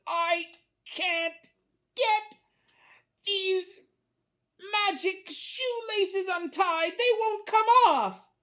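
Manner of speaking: high, effortful, broken word by word; straining, struggling boy. A narrator's reading. Boy tugging stuck laces, straining and high. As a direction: strain between words, bursting out loud at the end. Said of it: "really struggling to undo his shoes".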